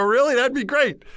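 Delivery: high, loud retold delight; delighted surprise. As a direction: act out a thrilled reply. Retold delighted reply to volunteer.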